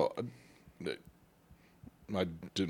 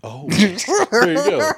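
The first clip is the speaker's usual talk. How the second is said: laughing; 13 semitones higher, 8 dB louder, much slower than usual. Real, not acted. Laughing at the Domino's joke.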